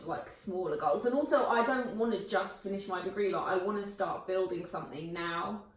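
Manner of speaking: ordinary talk, nothing marked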